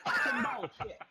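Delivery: half-laughing, breathless swear; laughing curse. Real, not acted. Player cursing the roll while laughing.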